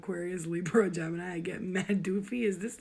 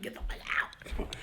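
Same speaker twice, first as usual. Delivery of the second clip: whispered, slow and annoyed; a host whispering her fed-up thought about houseguests; whispered get out; slower than usual. Retold or imitated. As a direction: hiss it under your breath. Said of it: "I'm like".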